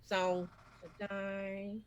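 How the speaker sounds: very slow, halting low intro; reviewer impression. Taking a turn impersonating a reviewer, extremely slow halting delivery. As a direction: drag each word out with long pauses. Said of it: "I'll go next then".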